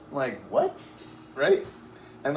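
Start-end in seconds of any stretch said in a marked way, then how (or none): 0.5-0.8 a sharp, sky-high incredulous yelp; baffled what. Retold or imitated. Man in his backyard answering the cop, shocked high yelp. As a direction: play total disbelief at the order. His reply to cop's order.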